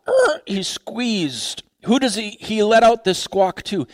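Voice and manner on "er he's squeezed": drawn-out strained voice; slow, like being squeezed. Acted. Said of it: "he lets out this squawk".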